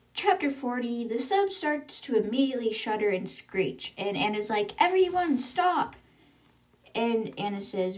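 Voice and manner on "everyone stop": high-pitched call to halt everyone; urgent command. Acted. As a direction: call out a sudden alarmed order. Sub shudders, captain orders halt.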